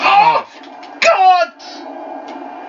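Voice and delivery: shrieking yell, high and loud; sudden high-pitched yelp of alarm. Real, not acted. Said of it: "[name] doesn't yell like that".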